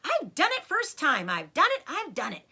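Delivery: high, loud, elated shouts; triumphant fox shouting; excited yelling. Acted. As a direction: crow with victory. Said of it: "he yelled".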